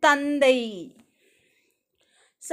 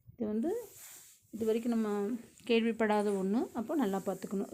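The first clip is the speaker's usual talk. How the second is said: softer, confiding aside to the class; quiet aside; 3 semitones lower, 10 dB quieter, much faster than usual. Real, not acted. Teacher to students, a quiet confiding aside. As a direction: drop the voice for a side note.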